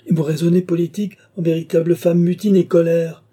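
high, loud exasperated rebuke; heated retort. A narrator's reading. King scolding the rebellious countess, heated.

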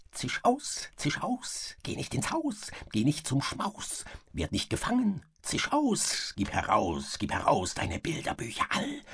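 high, hissing, sinister rodent voice; hissing mouse king. Acted. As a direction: hiss it like a wicked mouse king. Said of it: "zischte er".

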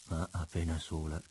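plain reading voice

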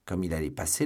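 plain reading voice

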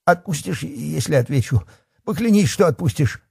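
high, pleading coward; terrified. A narrator's reading. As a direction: beg for a promise in a high frightened voice. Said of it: "Бахметьев в ужасе зажмурился".

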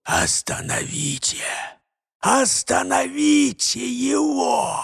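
dying man's hoarse, slow rasp. Acted. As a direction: rasp it out with the last of his strength. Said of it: "прохрипел".

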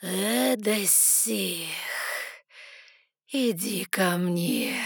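slow, sweet, luring divine voice; goddess. A narrator's reading. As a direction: beckon her like honeyed poison. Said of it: "Тягучим сладким ядом ... божественный голос".

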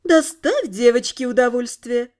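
a hint of a teasing fairy queen; lightly voiced queen. The queen calling down to Sid, teasing. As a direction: tease from above, playful.

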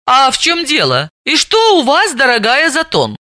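high, mock-astonished question; притворное удивление; feigned surprise. A narrator's reading. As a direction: act innocent surprise. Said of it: "изобразила безмерное удивление".

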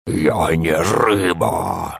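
slow, drawn-out creature wail; wailing monster voice. A narrator's reading. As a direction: stretch it like a swamp beast. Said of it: "завопило существо".

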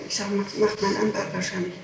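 ordinary talk, nothing marked

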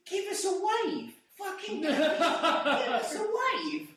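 put-on high, slow, yelling drunk woman on the bus. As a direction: bellow it down the bus like a raging stranger. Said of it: "but she was going".

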